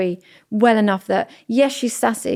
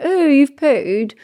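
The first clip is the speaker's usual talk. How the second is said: put-on teasing kid; 4 semitones higher, much slower than usual. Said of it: "you've gone".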